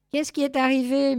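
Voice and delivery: lightly voiced cat asking urgently; meowing cat. A narrator's reading. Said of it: "miaula Secretario".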